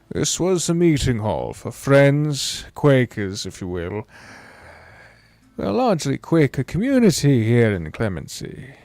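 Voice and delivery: put-on slow, unhurried old man's drawl. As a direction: stretch it out like a grandparent telling a tale. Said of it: "Oh, we gotta do a slow drawl".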